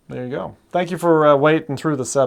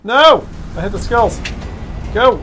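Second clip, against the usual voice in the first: frantic shouting; 6 semitones higher than usual. Real, not acted. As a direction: yell in racing panic. Frantic racing moment.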